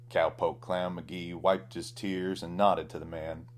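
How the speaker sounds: ordinary delivery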